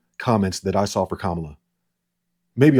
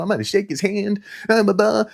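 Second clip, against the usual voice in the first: high mocking voice of Kamala; 10 semitones higher than usual. Mocking Kamala at the debate in a high voice. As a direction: raise the pitch and mock her chirpy line. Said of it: "She came out".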